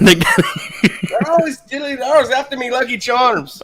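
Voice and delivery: loud, laughing mock Irish brogue; hammed-up Irish leprechaun. Player as the leprechaun for the host, hammed-up Irish. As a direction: play a cartoon leprechaun, slowly.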